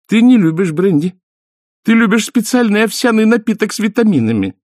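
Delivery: high, bossy wife's voice. A narrator's reading. Wife deciding her husband's tastes, high bossy tone. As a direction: correct him like a nanny. Mrs War corrects husband.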